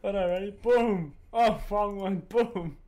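normal talking voice

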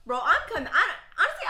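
laughing. Real, not acted. Laughing at the watch story.

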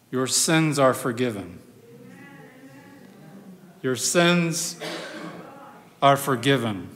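low, soft and solemn, with weighty pauses; hushed, low words of Jesus; gentle pronouncement. Acted. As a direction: quietly grant forgiveness, letting each phrase settle. Voices Jesus forgiving the man.

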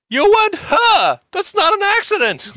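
a nerd squealing that it was no accident; shrieking nerd. Retold or imitated. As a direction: shriek an accusation at her. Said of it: "And he says".

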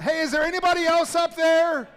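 high, loud shout skyward; desperate yell. Acted. Jack turns down God's plan and yells for someone else, loud and high. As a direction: yell past God for anyone else. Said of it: "Jack yells out".

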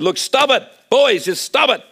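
a mother snapping at her sons to stop; exasperated mum breaking up a fight; mum scolding. Retold or imitated. Mum stopping fighting boys.

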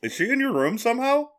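high, astonished question; real disbelief about the cat; surprised. Real, not acted. Cat suddenly appears in room.

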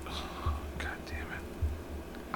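whispered curse under the breath; barely voiced, to oneself; muttered frustration. Real, not acted. To himself over a bad cigar, muttered frustration.

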